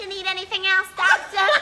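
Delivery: high, loud vampy nurse purr; flirty nurse. Retold or imitated. Nurse offering the doctor anything, high vampy voice. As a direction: play the gum-chewing nurse coming on to the doctor. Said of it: "She's like a prostitute".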